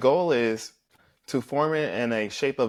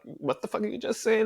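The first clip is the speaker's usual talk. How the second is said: much higher, incredulous; outraged disbelief; 10 semitones higher than usual. Retold or imitated. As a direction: shriek it in mock outrage. Retold outrage at a rumour.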